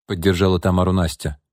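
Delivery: ordinary delivery, nothing marked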